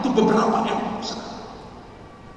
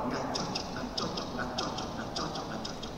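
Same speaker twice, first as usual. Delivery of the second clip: very low, hushed repetitions; dismissive murmur, retold; 8 semitones lower, 14 dB quieter than usual. Buyers turn down the house one after another, muttered low. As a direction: let each refusal drop away under your breath. Callers turning down the house repeatedly.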